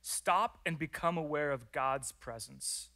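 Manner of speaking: slow, deliberate, stressing stop; slow first step. Shown as a demonstration. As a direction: lay out step one slowly, word by word. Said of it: "It says, number one".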